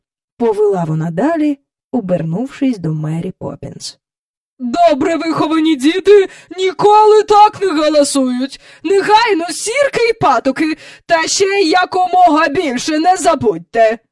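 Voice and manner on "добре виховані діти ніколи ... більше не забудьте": put-on high, loud, indignant old-fashioned lady; imperious scold. A narrator's reading. Visitor scolding the girl about stockings, imperious. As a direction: lecture the child in outrage.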